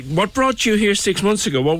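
normal talking voice

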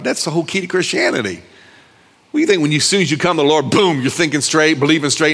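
ordinary talk, nothing marked

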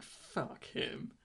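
hissed, bitter aside in a whisper; whispered demon voice. Retold or imitated. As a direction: spit it out under your breath. Demons voiced in a sibling analogy.

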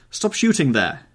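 high, loud shout across the field; calling out loud. A narrator's reading. Geoffrey shouting down the line to stop firing. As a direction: yell to halt the guns. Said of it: "he called out at the top of his voice".

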